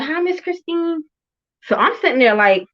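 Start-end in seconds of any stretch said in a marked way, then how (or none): 0.0-1.0 put-on higher, slower sweet kid voice; little girls greeting. Mother mimicking daughter's friends greeting her sweetly. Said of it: "And they're like".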